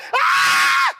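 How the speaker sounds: a sudden high shriek of horror; piercing gasp-scream of shock. Retold or imitated. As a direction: scream as if seeing a ruined friend. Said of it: "napasigaw sila eh".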